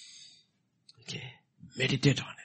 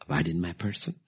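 usual speaking voice

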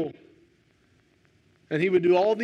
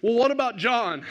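higher, pleading cry of Peter; 6 semitones higher than usual. Retold or imitated. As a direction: protest like Peter, a bit higher. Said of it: "And Peter cries out".

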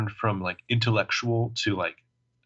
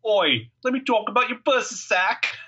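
mock British; 11 semitones higher than usual. Imitating Ren's British slang.

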